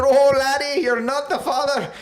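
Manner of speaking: put-on high, loud Irish accent, laughing; mock Irish brogue with a laugh. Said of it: "I made him Irish".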